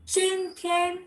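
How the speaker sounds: Chin Tian kept high and level, flat tone throughout. Shown as a demonstration. Teacher stressing the flat tone of Chin Tian. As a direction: hold Chin high and steady, no rise or fall. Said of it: "It's a flat tone".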